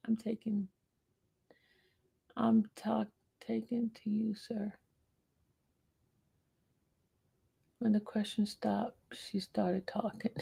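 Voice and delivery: hushed aside, barely voiced; whisper. Real, not acted. Whispering an aside to her chat while someone else is talking. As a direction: lean in and whisper to the viewers.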